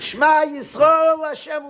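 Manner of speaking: high, loud declaration; proclaimed aloud. Shown as a demonstration. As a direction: belt out the Shema. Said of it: "then you whisper".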